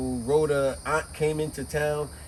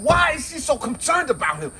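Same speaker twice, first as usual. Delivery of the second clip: exasperated; 11 semitones higher, 4 dB louder than usual. Retold or imitated. Speaker's own angry reaction.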